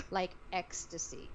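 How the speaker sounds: ordinary talk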